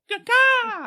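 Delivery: very high, loud squawked call; piercing two-note "ka-ka" cry; loud bird-like caw. Acted. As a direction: squawk out a secret signal. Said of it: "He'll probably go".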